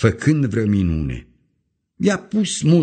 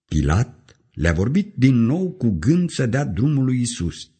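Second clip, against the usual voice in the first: ordinary delivery, nothing marked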